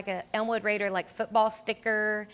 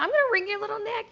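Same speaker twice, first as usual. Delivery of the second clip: high, quick mock-angry thought, much higher; mock inner fury; 11 semitones higher, faster than usual. Thinking about the tattling sax kid, high comic mock rage. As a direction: play the inner threat as a joke. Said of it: "but I thought".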